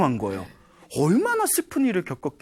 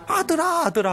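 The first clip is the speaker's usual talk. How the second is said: mock wailing; 12 semitones higher than usual. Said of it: "하고 울면서".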